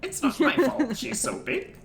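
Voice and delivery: high, laughing goblin. Acted. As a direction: whine like a giggling goblin. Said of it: "Goblin voices".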